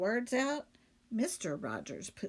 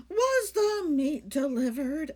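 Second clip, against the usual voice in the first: housewife voice; 5 semitones higher, 5 dB louder than usual. Acted. Missus Rogers character voice.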